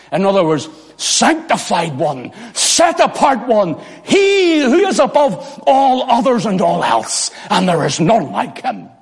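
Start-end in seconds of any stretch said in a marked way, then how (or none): 2.5-7.3 high, drawn-out declaration; exalting proclamation. Acted. Exalting God in seraphim's words.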